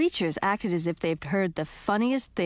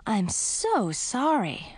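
sympathetic; 4 semitones higher, much slower than usual. A narrator's reading. Sympathy to spider.

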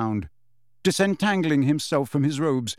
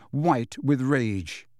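usual speaking voice

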